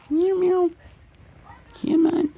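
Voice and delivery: very high squeaky meowing; high-pitched call to a pet cat. Real, not acted. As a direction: coax the cat in a tiny high voice. Calling the cat in meows.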